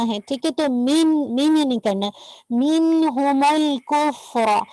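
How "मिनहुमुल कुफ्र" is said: drawn out slowly, held letters; slow recitation. Shown as a demonstration. Teacher models the phrase slowly for the student. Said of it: "फा पे हम से हो रहा है".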